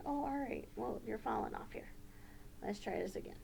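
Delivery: ordinary delivery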